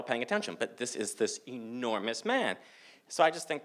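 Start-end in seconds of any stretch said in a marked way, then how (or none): none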